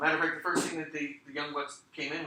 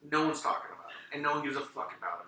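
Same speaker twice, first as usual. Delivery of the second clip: ordinary delivery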